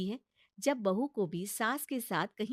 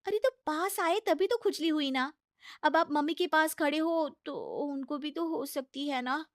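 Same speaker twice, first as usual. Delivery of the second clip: higher, coaxing bahu voice; pleading daughter-in-law; 6 semitones higher, 3 dB louder than usual. Acted. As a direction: talk sense into a stubborn mother-in-law. Bahu schemes about itch. (ऊँची, मनाती हुई बहू की आवाज़)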